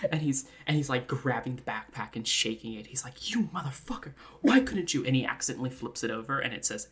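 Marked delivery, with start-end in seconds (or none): 3.3-5.0 hissed curse rising into higher, louder protest; exasperated cursing. Acted. Character shakes the backpack, cursing in exasperation. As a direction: scold it in mock fury.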